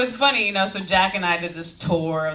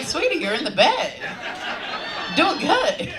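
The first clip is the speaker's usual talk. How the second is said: mock sweet reassurance; 10 semitones higher than usual. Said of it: "It's like".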